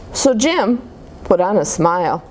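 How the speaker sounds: ordinary delivery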